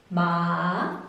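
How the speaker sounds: "Ma" low, then rising; third tone. Shown as a demonstration. Teacher modelling for repetition, "Ma" dipping then rising. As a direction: dip "Ma" and bring it back up. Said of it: "Repeat after me".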